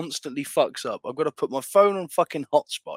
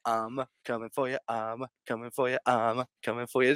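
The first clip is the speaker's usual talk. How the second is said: voicing the bear; 5 semitones lower, 7 dB quieter than usual. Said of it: "this bear's like".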